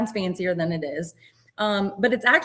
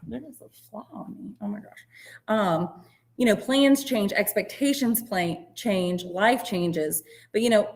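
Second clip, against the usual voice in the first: ordinary talk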